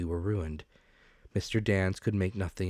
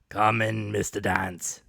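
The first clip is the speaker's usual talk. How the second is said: a lordly, patronizing welcome, a bit louder; stately and condescending; 5 dB louder, slower than usual. A narrator's reading. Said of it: "very stately and condescending".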